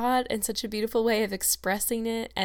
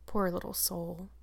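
much softer, tender murmur; 7 dB quieter than usual. Acted. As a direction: murmur it over a sleeping child. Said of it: "she murmured".

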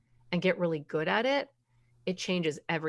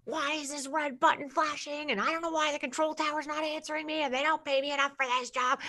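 panicking captain; 7 semitones higher than usual. Retold or imitated. Captain losing it in the cockpit, frantic mock panic. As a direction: lose it in the cockpit. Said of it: "the captain's in there screaming and yelling".

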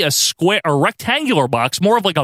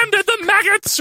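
very high gleeful wail, drawn out; hammed-up falsetto manager gloating; happy Paul Bearer impression. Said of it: "Paul Bearer rambles on about Undertaker".

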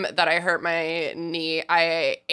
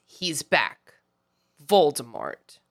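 low, grave, with a long pause; a hint of solemn Harry; dramatic pause; 5 semitones lower, slower than usual. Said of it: "Dramatic pause".

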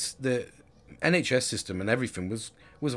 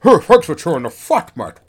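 loud, exaggerated squeaky high voice; hammed-up high-pitched shout; mock high-pitched voice; 7 semitones higher, 16 dB louder than usual. Shown as a demonstration. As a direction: send up your own voice crack, much higher and louder. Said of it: "that was a high-pitched voice".

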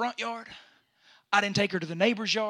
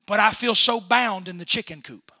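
building sermon; 12 dB louder than usual. Acted. Preaching builds.

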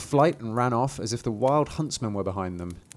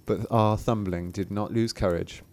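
usual speaking voice

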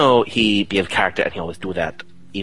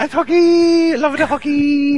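high, loud, slow fan chant; mock hockey cheer; 7 dB louder, slower than usual. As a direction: whoop it up to wind him up. Teasing chant about hockey.

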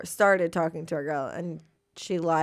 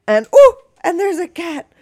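higher, louder startled cry; surprised squeal; 10 semitones higher, 10 dB louder than usual. Real, not acted. Startled by the cat jumping up mid-story. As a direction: yelp at the sudden cat.

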